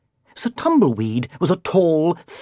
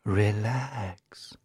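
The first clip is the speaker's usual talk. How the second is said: low, softer, soothing witch voice; calm him down gently; low and pleasant; 6 semitones lower, 10 dB quieter than usual. A narrator's reading. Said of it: "her voice was low and pleasant".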